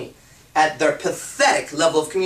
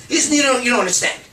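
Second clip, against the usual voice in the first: high, louder frustrated voice; exasperated reply; 6 semitones higher, 3 dB louder, faster than usual. Acted. Woman cutting off her confused man, exasperated. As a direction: cut him off, out of patience.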